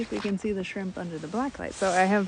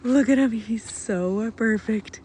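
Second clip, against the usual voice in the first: slow, louder gush; besotted with the fish; adoring; 3 semitones higher, 6 dB louder, slower than usual. Real, not acted. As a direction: linger over each word.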